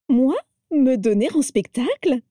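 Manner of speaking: bright, high, teasing protest; mock outrage, high and lively; playful protest. A narrator's reading. Vera protesting playfully to Abraxas. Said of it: "protesta Vera sur un ton enjoué".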